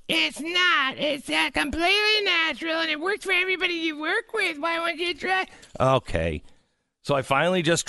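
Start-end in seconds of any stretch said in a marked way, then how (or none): 0.1-5.5 high put-on nagging wife voice; mock wife. Host imitating his wife nagging him, in a high put-on voice. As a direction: squeak it like an insistent wife. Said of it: "I'm like".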